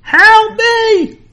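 very high, loud scream; screaming for help. Acted. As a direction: scream it from the top of your lungs. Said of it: "screaming at the top of his lungs".

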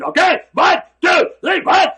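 loud drill-sergeant counting; barked counts. Retold or imitated. Trainer barking counts at the exercisers, loud. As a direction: bark the count at the room.

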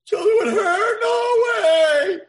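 high, loud, crying protest; horrified wail. Acted. Son shrieking at father about marrying Hilda. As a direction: howl it out in disgust.

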